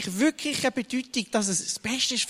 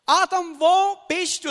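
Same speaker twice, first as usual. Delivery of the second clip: high, louder call, a searching "wo bist du"; God calling out; 8 semitones higher, 6 dB louder than usual. Acted. God searching for Adam in the garden, calling out. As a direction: call his name, then ask "wo" with weight. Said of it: "Oder man könnte doch auch sagen".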